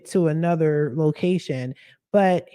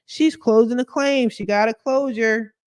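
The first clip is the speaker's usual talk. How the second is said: mock celebration; 7 semitones higher than usual. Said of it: "clapping, high-fiving".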